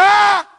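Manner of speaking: very high, loud "ah!"; terrified shout. Acted. Preacher acting the listener's fright, very high loud scream. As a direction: scream in sudden terror. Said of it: "You be saying".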